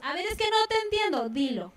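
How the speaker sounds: high pleading rise, dropping to a firm order; exasperated mother. Acted. Mother to crying child, acted exasperation. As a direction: mom losing patience with a child. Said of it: "las mamás nos desesperamos".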